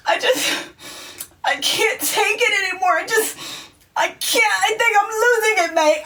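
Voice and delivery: high, broken sobbing with British mate; tearful whimpering, mock British; crying friend. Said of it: "She's full on in tears".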